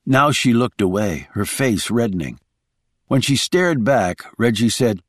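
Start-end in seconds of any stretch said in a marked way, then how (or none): none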